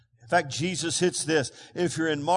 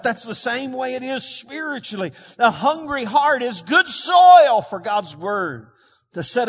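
fervent, louder pulpit voice; preaching; 4 semitones higher, 6 dB louder than usual. Acted. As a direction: press it on the congregation. Preacher applying the point.

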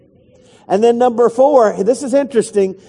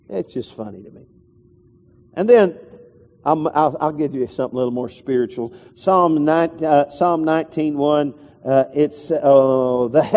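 normal talking voice

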